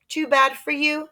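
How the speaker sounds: high, smug dismissal; smug girl. Acted. Sheila brushing off Jimmy and Peter, high smug taunt. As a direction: brush them off in a high cheeky voice. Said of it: "Sheila said".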